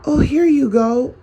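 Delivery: playing the easily fooled helper; mock gullible reply. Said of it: "you'll say to yourself".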